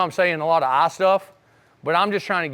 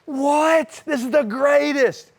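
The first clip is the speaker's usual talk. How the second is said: higher, slowed-down awe at the greatest car; a teen seeing his new Nova, high and wowed; amazed delight; 8 semitones higher, much slower than usual. Retold or imitated. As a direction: savour it like a dream come true. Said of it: "I was like".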